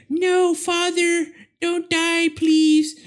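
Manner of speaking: high, slow melodramatic plea; pleading daughter. Retold or imitated. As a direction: beg him in hammed-up grief. Daughter begs again.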